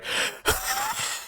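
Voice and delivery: high, wheezy psychotic evil laugh. Pretend. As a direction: cackle like a wheezing madman. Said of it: "now do your evil laugh".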